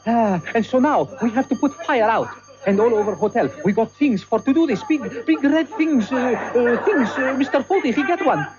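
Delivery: put-on broken English with a heavy Spanish accent; bumbling Spanish waiter recounting chaos. Waiter narrates fire chaos to listeners, heavy Spanish accent.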